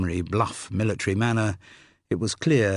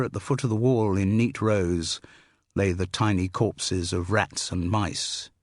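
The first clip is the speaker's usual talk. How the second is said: ordinary delivery, nothing marked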